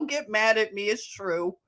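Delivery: ordinary talk, nothing marked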